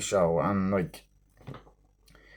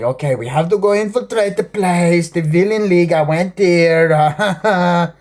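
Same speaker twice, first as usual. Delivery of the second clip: hammed-up villain; 7 semitones higher, 5 dB louder than usual. Acting out the villain's line.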